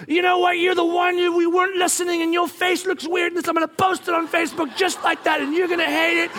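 high-pitched angry rant; screaming at them. Retold or imitated. Dad scolding kid who ignored him, screaming rant. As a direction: rant on one high, strained note. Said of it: "I'm screaming at them".

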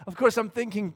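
normal talking voice

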